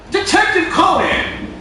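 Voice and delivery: high-pitched, drawn-out exclamation; incredulous outburst. Real, not acted. Telling friends about an absurd kids' licence, incredulous outburst. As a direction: cry the title out slowly in disbelief.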